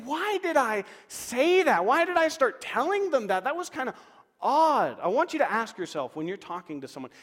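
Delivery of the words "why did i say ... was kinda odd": exasperated self-questioning. Retold or imitated. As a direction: replay the blunder in mock dismay. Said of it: "you think".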